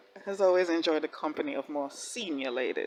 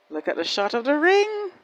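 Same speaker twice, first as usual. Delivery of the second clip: higher, swooning over ring; gushing excitement; 9 semitones higher, 4 dB louder than usual. Real, not acted. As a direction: gush about the ring shot, pitched up. Repeat, higher gush.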